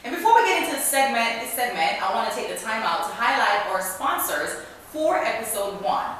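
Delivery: loud, upbeat show-opening announcer read; podcast host intro. Acted. Host welcoming listeners and plugging the sponsor, announcer style.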